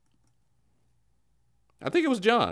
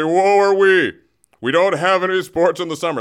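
louder, higher hammed-up wail; mock lament; 4 semitones higher, 6 dB louder than usual. Anchor mimicking fans whining about no summer sports, mock wail. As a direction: groan it out self-pityingly. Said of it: "They say".

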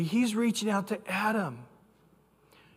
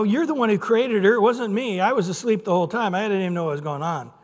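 louder, fast, defensive blaming; whiny excuse; 2 semitones higher, 5 dB louder, faster than usual. Retold or imitated. Adam blaming God for the woman, louder and fast. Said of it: "Adam wasn't very good at it".

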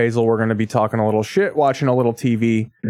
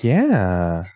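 sultry yeah; 5 semitones lower than usual. Retold or imitated. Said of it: "at work, I've been like".

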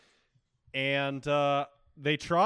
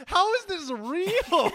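high, laughing disbelief; cracking up mid-question; 8 dB louder, slower than usual. Real, not acted. Friend laughing at cartoon voice.

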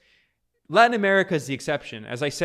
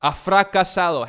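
louder Spanish, fracasado hit hard; Spanish quote; 3 semitones higher, 5 dB louder than usual. Retold or imitated. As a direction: read the Spanish like a solemn declaration. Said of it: "He says".

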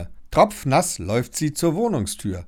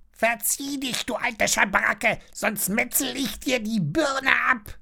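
high squeezed parrot threat; high-pitched, menacing bird croak; 12 semitones higher than usual. A narrator's reading. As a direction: squawk insults like a pirate bird. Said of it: "Da krächzt der Papagei".

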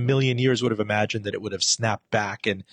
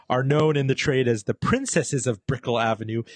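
normal talking voice

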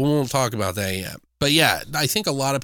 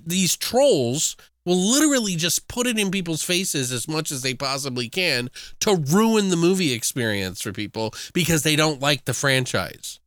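usual speaking voice

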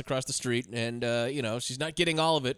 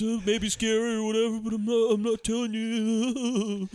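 higher, drawn-out singsong put-on voice; creepy son; 5 semitones higher than usual. Creepy son hinting about his dad, coy singsong. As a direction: stretch it out like a coy, creepy kid.

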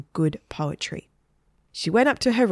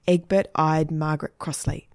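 plain reading voice

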